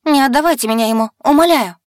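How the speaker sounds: high, loud, fast wailing plea. A narrator's reading. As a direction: beg loudly in panic. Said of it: "завопила я".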